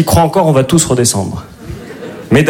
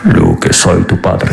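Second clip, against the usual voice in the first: much lower, slow mock Vader in Spanish; Spanish-dubbed Darth Vader; 8 semitones lower, slower than usual. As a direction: boom it out slow like a reggaeton Vader. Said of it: "Dark Vador s'exprime dans la langue du reggaeton".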